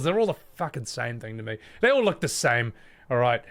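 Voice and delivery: ordinary talk, nothing marked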